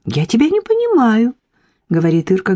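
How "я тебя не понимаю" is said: feigned naivety. A narrator's reading. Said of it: "широко открытыми наивными глазами".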